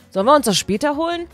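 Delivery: usual speaking voice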